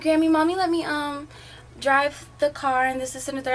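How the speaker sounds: bubbling with news for grandma; excited. Retold or imitated. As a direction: retell it like an excited kid. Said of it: "I'm excited".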